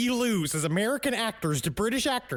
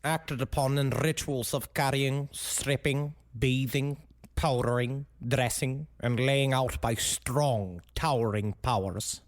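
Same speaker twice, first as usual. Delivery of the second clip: lower, slow, dramatic reading of a quotation; solemn quote reading; 6 semitones lower, slower than usual. Retold or imitated. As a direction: read the list gravely, stretching strong and towering.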